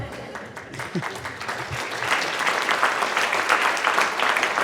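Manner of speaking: a shaky, breathy laugh through emotion; emotional laugh. Real, not acted. Speaker laughing through emotion after thanking a late mentor. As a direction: laugh it off to hold back tears.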